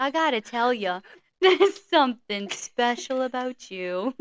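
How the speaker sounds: slow mock-tender declaration; teasing, sappy sweet-talker voice; Gary impression. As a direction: draw it out like a smitten suitor. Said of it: "how Gary talks".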